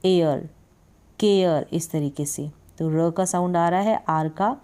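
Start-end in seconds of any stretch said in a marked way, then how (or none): 0.0-1.7 teacher demonstrating केयर; care pronunciation. Shown as a demonstration. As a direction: model केयर with the r sound. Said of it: "सी को क".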